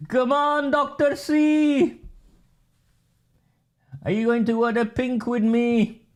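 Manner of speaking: high, sing-song taunting voice; mock teasing. Goading Doctor C to join Oldham Pride, in a high mocking voice.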